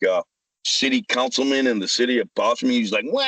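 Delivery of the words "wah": short, high-pitched mock "wah"; mock whining wail. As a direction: imitate a crybaby's wail. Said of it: "He's whining".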